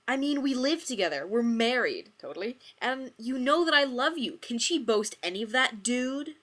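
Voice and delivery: high, loud hurt rant; loud jealous rant. Acted. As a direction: rant at him, wounded and loud. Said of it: "Joey continued to rant".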